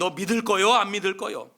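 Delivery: loud, threatening ultimatum; loud demand. Acted. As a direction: push hard for an answer. Inmate pressuring newcomer.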